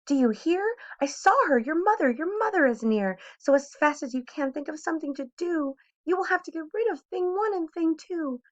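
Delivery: high, pressing fish warning; urgent fish. A narrator's reading. Fish tells the boy mother is near, high and urgent.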